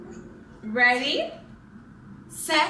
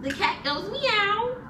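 high, slow sing-song; cat teacher; 8 semitones higher, slower than usual. Shown as a demonstration. Mom teaching baby the cat sound again, high and slow.

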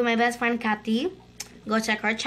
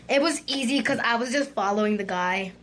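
normal talking voice

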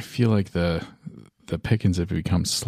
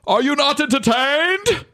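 high, drawn-out arena bellow; hammed-up gladiator shout; Maximus; 4 dB louder, slower than usual. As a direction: cry it out slowly to a whole crowd. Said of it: "does he say it like that?".

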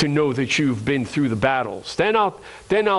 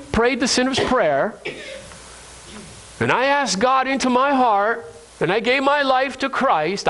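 higher-pitched, lightly acted protest; protesting churchgoer; 8 semitones higher than usual. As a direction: object to the pastor as a let-down believer. Voices a congregant's protest to pastor.

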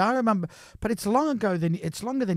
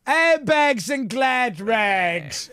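slow shouted Scottish announcement; broad Scottish accent; 5 semitones higher, much slower than usual. Retold or imitated. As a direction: call it out in a thick Scottish accent. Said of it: "in this strong Scottish accent".

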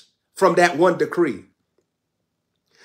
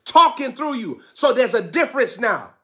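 normal talking voice

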